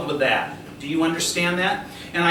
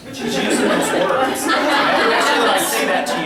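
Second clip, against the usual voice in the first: laughing; 4 semitones higher, 4 dB louder than usual. Real, not acted. Amused at spirit's swearing.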